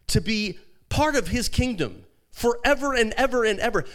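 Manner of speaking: high, loud, impassioned sermon; full-force preaching. Acted. Pastor urging the congregation, full-force preaching. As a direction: preach it out to the crowd.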